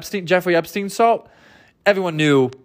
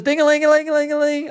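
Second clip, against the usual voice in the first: siren imitation; 8 semitones higher, faster than usual. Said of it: "he's an old-timey, um, cop".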